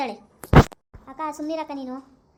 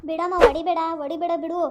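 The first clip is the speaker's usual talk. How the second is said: high, loud cry of don't hit me; frightened pleading; 3 semitones higher, 6 dB louder than usual. Acted. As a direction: beg her mother to stop, scared. Daughter begs not to be hit.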